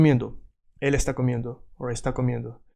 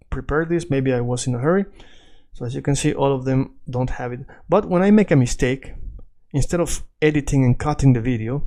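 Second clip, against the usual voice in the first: ordinary talk, nothing marked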